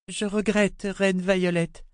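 lightly voiced girl, careful with the title; bow to the queen with the word reine; girl correcting herself. A narrator's reading. Girl correcting herself before the queen, meek. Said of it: "dit-elle".

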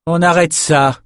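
a calm sorceress ordering thugs to stop; firm command. A narrator's reading. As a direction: stop them cold with authority. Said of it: "Sans crier, mais d'un ton assez ferme".

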